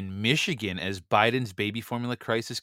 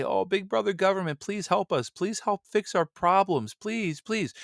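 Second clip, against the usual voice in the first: high, whiny begging to big brother government; mocking people crawling to the government for help; mock pleading citizen; 10 semitones higher than usual. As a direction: beg like a helpless subject, hammed up. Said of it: "and say".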